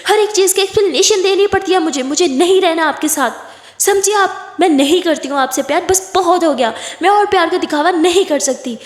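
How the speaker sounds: high, heated rejection; angry outburst. Acted. Aditi rejecting Kiran's love, angrily fed up.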